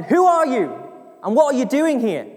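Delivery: high, loud challenge from above; booming, high-pitched demand held to the end; booming sentry. Acted. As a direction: shout it down like a sentry on the wall. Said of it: "the sentry says again".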